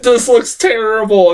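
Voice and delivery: high-pitched exasperated disbelief; worked-up reenacted reaction; infuriated. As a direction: retell it with indignant punch. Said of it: "I was infuriated".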